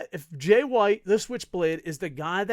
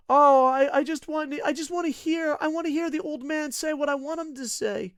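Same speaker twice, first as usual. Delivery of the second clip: mock whiny fan; 10 semitones higher, 5 dB louder than usual. Mocking fans' wishes.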